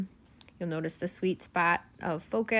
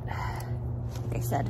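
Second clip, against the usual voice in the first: whisper; much slower than usual. Real, not acted. Aside while bribing her daughter.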